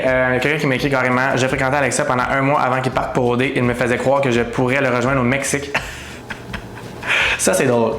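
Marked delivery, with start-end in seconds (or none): 5.8-7.3 soft breathy laugh. Real, not acted. Laughs at a DM she read out.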